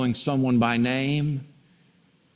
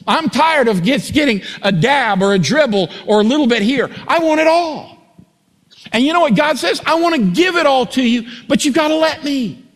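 rising from earnest to full-throated preaching; climbing to a fervent, high, loud preach; building preacher fervor; 7 semitones higher, 3 dB louder than usual. Acted. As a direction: build the sermon to a passionate peak.

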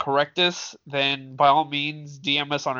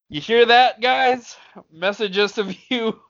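raised, high-pitched joking appeal to listeners; mock-urgent public appeal, pitched high; playful call-out; 8 semitones higher, 4 dB louder than usual. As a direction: call out to the audience, laughter about to break through.

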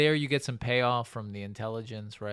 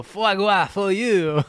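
even higher, slow mock French; French accent; 8 semitones higher, 9 dB louder, slower than usual. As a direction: stretch it out like a grand Frenchman. Repeat, bigger French flourish.

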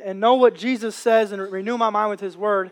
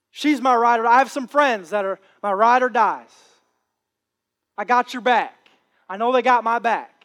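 ordinary talk

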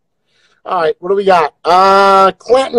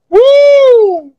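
excited whoop; 9 dB louder than usual. Real, not acted. Trying face filters, excited whoop.

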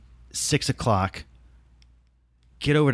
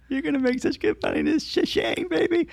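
much higher, put-on buddy voice; imitating James's buddy voice. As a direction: mimic a chummy co-star, way up high. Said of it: "And he's like".

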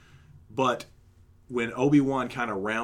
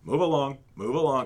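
higher, slightly louder mimicked order; lightly voiced stormtrooper repeating orders; parroting stormtrooper; 4 semitones higher, 5 dB louder than usual. Stormtrooper echoing Obi-Wan's words, parroting. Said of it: "is literally parroting everything that Obi-Wan".

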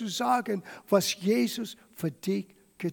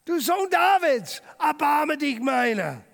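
loud, higher desperate cry; 5 semitones higher, 5 dB louder than usual. Acted. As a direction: shout it louder over the crowd. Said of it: "Er aber schrie noch viel mehr".

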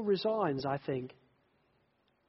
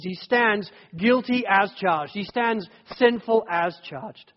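rising, louder preaching voice; 2 semitones higher, 8 dB louder than usual. Acted. Preacher driving home guilt to the congregation, building force.

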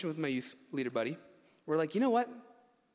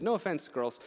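ordinary talk

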